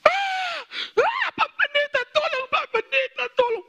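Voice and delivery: high, loud wailing cry for help; panicked scream for help. Retold or imitated. Wife screaming to the pastor from behind during prayer, panicked scream. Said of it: "sudah teriak di belakang".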